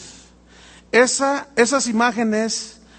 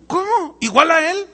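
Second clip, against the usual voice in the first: amazed; 8 semitones higher, 3 dB louder than usual. Retold or imitated. Eve amazed by the promise.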